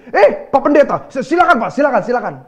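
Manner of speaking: bow and scrape like an embarrassed churchgoer; sudden politeness. Retold or imitated. Driver recognizing his pastor, suddenly loud and fawning. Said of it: "dia bilang begini".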